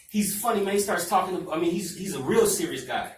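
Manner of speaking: ordinary talk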